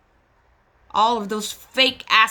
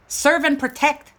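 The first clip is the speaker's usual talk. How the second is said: ordinary delivery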